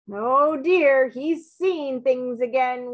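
usual speaking voice

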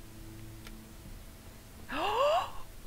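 long, high gasp; startled gasp. Real, not acted. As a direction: suck in a long shocked breath. Startled by the game.

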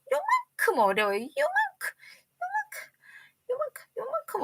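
very high thin 요만큼; high little-voice for tiny; tiny squeak. Acted. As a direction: squeak it to show how small. Showing a tiny amount.

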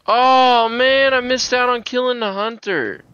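gutted about the missed kill; frustrated outburst. Real, not acted. Streamer missing out on killing the hunter, frustrated.

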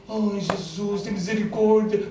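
high, drawn-out mock wail; mock pleading. Preacher imitating someone begging Jesus for mercy, mock pleading. Acts out a desperate plea.

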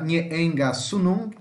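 nheenga sunung said slowly, stress on the last syllables; drawn out like a model for students; teacher modelling nheenga sunung; slow careful demo. Shown as a demonstration. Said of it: "é equivalente à oxítona".

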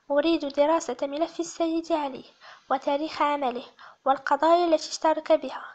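plain reading voice

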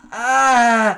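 sudden high loud frustrated cry; short angry shout of frustration; frustrated yell. Real, not acted. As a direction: let out a real yelp of rage. Raging at the game.

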